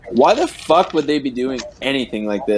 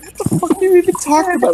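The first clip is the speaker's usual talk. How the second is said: high, fast disbelief; incredulous; faster than usual. Retold or imitated. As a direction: fire it off in disbelief, pitch up. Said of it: "and they were like".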